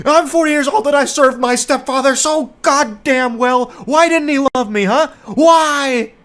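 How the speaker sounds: a grown man whining for his stepfather; mock whining. Mocking the forty-year-old coworker, high loud whining. Parodying coworker's self-pity.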